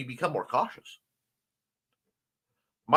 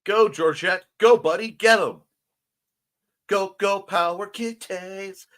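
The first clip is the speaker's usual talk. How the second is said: bright, loud cheer for a cat; root for the kitty, louder; 6 semitones higher, 5 dB louder than usual. Real, not acted.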